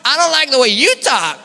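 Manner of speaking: "you" punched high and loud in a put-on preacher accent; David Hogan's accent. Preacher answering a critic, "you" loud in his accent. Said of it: "but he has this accent".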